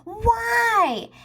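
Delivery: retold scream. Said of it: "I screamed at my three-year-old".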